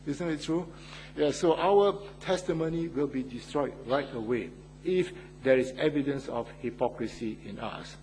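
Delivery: ordinary talk, nothing marked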